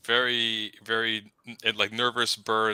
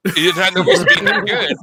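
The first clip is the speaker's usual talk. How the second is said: laughing repeat; 8 semitones higher, 10 dB louder than usual. Real, not acted. Repeats friend's line, laughing.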